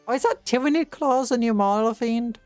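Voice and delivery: ordinary talk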